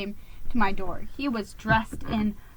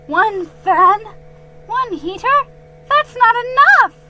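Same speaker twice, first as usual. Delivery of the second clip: high, loud outraged squeak; squeaked protest; 12 semitones higher, 11 dB louder than usual. A narrator's reading. Geronimo protesting the tiny fan and heater, high and loud. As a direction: protest in disbelief. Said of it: "I squeaked".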